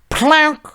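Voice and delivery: high, sudden "plonk" sound effect; imitated clunk on a hotel desk; imitated card thud. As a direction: voice the heavy card hitting the desk. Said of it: "makes a bigger noise".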